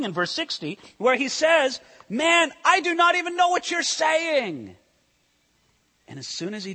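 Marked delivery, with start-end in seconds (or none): 2.1-4.8 high, loud, exasperated denial; Peter's high denial. Retold or imitated. Preacher voicing Peter's last denial to the crowd, high and exasperated. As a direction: brush them off, pitched up.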